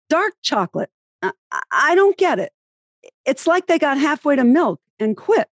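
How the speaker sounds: John Madden impression. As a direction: muse aloud on a bouncing bus, baffled by candy. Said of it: "And then he'd say".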